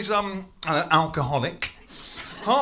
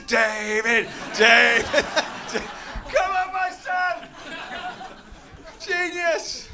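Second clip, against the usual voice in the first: cheering fans; 11 semitones higher, much slower than usual. Pretend. People at back cheering.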